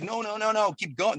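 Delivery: high, frantic pleading; begging him not to stop the car; panicked wife. Retold or imitated. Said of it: "she's like".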